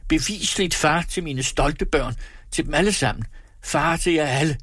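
high, self-important declaration; pompous, aggrieved insistence; indignant boasting. A narrator's reading. Acted quarrel, man asserting fatherhood.

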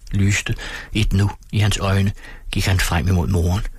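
ordinary delivery, nothing marked

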